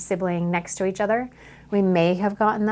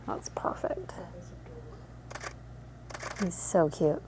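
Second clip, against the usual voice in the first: tender whisper; 3 semitones lower, 12 dB quieter than usual. Real, not acted. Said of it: "cooing at the newborn".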